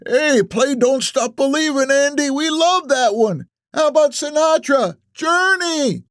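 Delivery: high-pitched eager crowd call; old patron shouting. A narrator's reading. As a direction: shout song requests at the performer. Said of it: "Another patron shouts".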